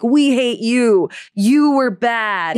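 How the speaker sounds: hammed-up, drawn-out blame; mock condemnation. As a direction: stretch each word like a pretend rejection. Mock rejection from casting people.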